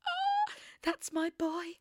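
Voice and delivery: high, slow, crying voice; choked up with pride; tearful tenderness. Retold or imitated. Proud owner hearing her cat woke up, tearful. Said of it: "And I was like".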